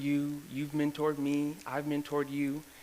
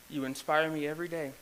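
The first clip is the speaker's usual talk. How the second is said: normal talking voice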